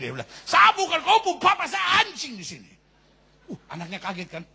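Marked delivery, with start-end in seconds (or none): 0.4-2.7 put-on high, loud drunken yell again; angry shout. Drunk father yelling angrily at his child once more. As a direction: roar it out. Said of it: "dia so marah sekali".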